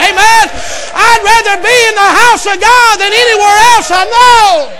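loud, high, rhythmic preaching; preaching at full force. Acted. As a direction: preach it hard to the congregation.

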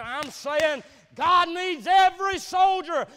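loud, high, driving sermon; preaching at full force. Acted. Preacher telling the church about the war, loud preaching. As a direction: build the sermon up hard and loud.

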